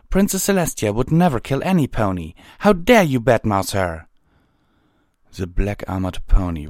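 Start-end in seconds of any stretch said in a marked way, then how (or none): 0.1-4.1 high, loud, quick outrage; indignant. A narrator's reading. Twilight defends Princess Celestia to a stranger, loudly indignant. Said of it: "Twilight said indignantly".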